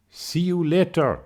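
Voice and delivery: high-pitched imitated call from afar; mock shout back. French woman calling back from down the cliff, imitated shout. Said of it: "one of them shouted back".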